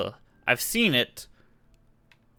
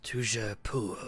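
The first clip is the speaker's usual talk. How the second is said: whispering a secret password; 2 semitones lower, 11 dB quieter than usual. Pretend. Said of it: "Whisper the password".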